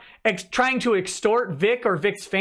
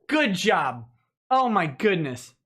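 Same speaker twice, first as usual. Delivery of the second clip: ordinary talk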